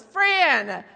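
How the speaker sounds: high, loud, slow insistence; exasperated mock dad. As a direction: scold loudly as the fed-up husband.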